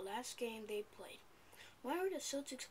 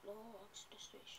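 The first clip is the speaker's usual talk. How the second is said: whisper; 9 dB quieter than usual. Real, not acted. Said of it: "Is that something exciting that's happening?".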